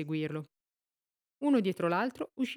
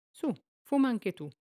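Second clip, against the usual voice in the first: put-on cocky boy; 5 semitones higher than usual. A narrator's reading. Boy offers cigarette mockingly.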